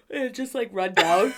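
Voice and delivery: words stretched by an oncoming yawn; real yawn creeping in; starting to yawn. Real, not acted. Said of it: "thinking about tired made me yawn".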